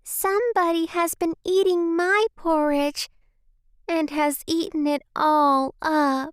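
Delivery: put-on high-pitched squeaky baby bear; small squeaky baby voice. A narrator's reading. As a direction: squeak it out like a tiny bear cub. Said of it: "said in his small, squeaky baby voice".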